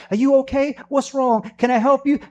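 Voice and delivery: a partner hovering with concern; worried woman. Retold or imitated. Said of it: "your woman be like".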